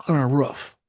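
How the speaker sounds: usual speaking voice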